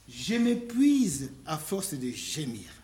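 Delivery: drawn-out, weary reading; slow reading. Real, not acted. Preacher reading the psalmist's lament, slow and weary. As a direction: read it slowly like exhaustion.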